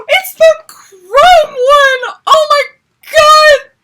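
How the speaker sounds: high, very loud gasp and scream; screaming excitement. Real, not acted. Fan discovering the chrome mirror, screaming excitement. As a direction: scream it slowly, nearly crying.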